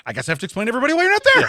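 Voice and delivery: fast, high and loud put-upon complaint; mock exasperated. Quoting a friend's text, fast mock exasperation. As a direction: rattle it off like a sulky friend. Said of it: "his next sentence goes".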